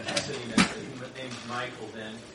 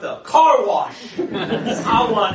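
loud, very slow laughing plea; eager Peter; 7 semitones higher, 14 dB louder, much slower than usual. Acted. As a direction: beg for the whole car wash, laughing. Peter begging full washing.

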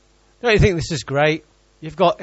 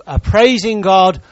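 normal talking voice

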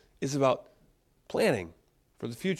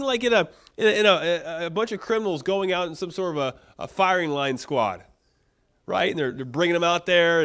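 usual speaking voice

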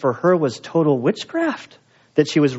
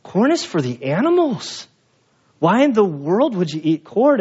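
mock disgust, pitched up; incredulous Spanish friends; 4 semitones higher than usual. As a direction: scoff at eating corn, lightly voiced. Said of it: "that they, like".

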